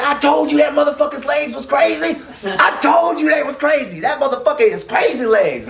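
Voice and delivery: high yell across the yard. Retold or imitated. Homeboy Thor from the weight pit, a high excited yell. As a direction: shout it from the weight pit, hyped. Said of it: "he yells from the weight pit".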